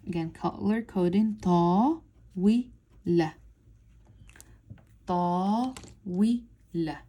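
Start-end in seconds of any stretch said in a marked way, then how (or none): 1.4-7.0 "ta, wi, la" in three separate beats; syllable by syllable. Shown as a demonstration. Teacher color coding the word, sounding out each syllable. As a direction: sound out "ta", "wi", "la" one at a time. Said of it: "watch how I'm doing it".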